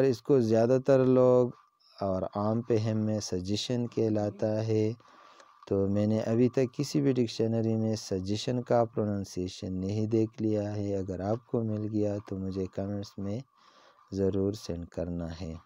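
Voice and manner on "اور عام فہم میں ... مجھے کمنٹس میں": usual speaking voice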